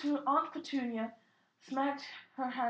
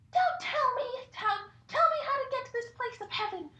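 put-on much higher, fast, pleading little boy; wistful child cry; 11 semitones higher, 5 dB louder than usual. Harry begging Hagrid about heaven, high pleading child voice. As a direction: beg eagerly like a small child. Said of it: "Harry cried wistfully".

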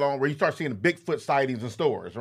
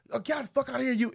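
mock aggressive; 10 semitones higher, faster than usual. Imitating staff turning away a customer, mock aggressive. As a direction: act out a hostile send-off at a higher pitch. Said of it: "It wasn't aggressive. It was never aggressive".

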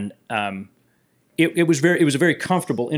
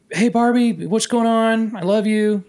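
bright, higher affectionate hello; warm greeting; 9 semitones higher than usual. Retold or imitated. Driver greets his sister Barbara on the phone, affectionate and higher. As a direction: greet your big sister fondly, pitched up.

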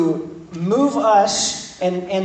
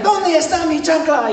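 Spanish-speaking mom; 9 semitones higher, 4 dB louder than usual. Retold or imitated. Angry mom looking for her sandal, mock Spanish yell. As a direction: hunt for the sandal, hammed up. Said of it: "they take the sandal off".

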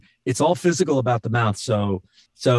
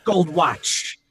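higher, halting monologue impression; Christopher Walken; 4 semitones higher, slower than usual. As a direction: chop it up with odd gaps. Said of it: "Christopher Walken doing that".

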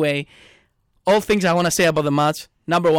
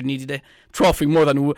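normal talking voice

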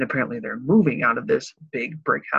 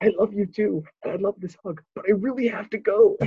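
mock pleading; 7 semitones higher than usual. Retold hug, groom pleading to leave.

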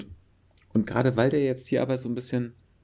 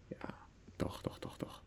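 whispering; 18 dB quieter than usual. Real, not acted. Playful insisting whisper.

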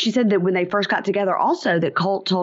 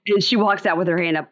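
ordinary delivery

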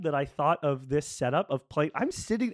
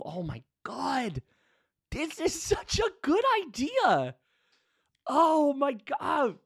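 awed excitement; 10 semitones higher, slower than usual. Real, not acted. Thrilled by own idea.

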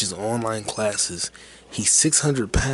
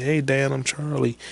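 higher-pitched imitated introduction; raised, friendly-hail voice, lightly acted; calling back; 5 semitones higher than usual. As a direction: call back as the retold customer. Customer calling reply to courier.

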